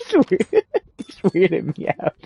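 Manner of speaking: high, giggly through the words; helpless high laughter breaking into speech; laughing. Real, not acted. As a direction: crack up while talking. Laughing at how car sounds.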